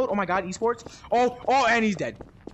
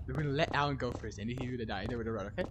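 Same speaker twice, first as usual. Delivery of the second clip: hushed whisper; 11 semitones lower, 13 dB quieter than usual. Real, not acted. Whispering the escape plan.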